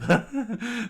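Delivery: high, loud villain cackle; demented cackle. A narrator's reading. Demented bandit popping up round a corner, cackling. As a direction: burst out in mad laughter. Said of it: "the demented one popped up".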